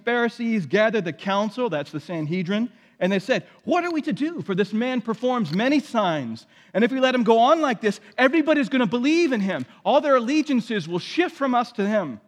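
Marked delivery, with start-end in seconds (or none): 3.6-12.2 alarmed. Acted. Alarmed priests voiced in retelling.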